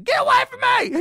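high-pitched shriek to back off; hammed-up screech, imitating an outraged woman; mock angry yell. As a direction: yell it way up high, fending someone off. Woman yells at him, acted out.

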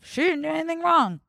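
talking with a mouthful of food; chewing while talking. Real, not acted. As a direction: speak while chewing a bite. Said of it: "Sorry, I'm chewing".

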